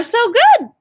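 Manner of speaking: happy teacher praise; excited praise. Real, not acted. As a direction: cheer them on. Cheering the kids on.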